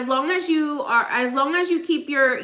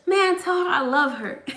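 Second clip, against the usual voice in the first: mock voice of the great-grandmother, pitched higher; imitating great-grandmother; 6 semitones higher than usual. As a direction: lightly play the old lady sending love. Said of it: "repeat it the way she said it".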